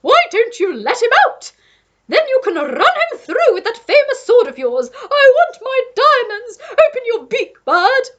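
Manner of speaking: high, loud imperious diva; Duchess demanding. A narrator's reading. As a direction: demand her diamonds back haughtily. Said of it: "cried the Duchess".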